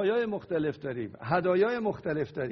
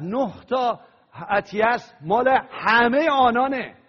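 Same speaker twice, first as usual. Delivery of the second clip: louder preaching, pressing each point; 3 semitones higher, 7 dB louder than usual. Acted. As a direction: drive the point home to the church. Preaching emphatically to congregation.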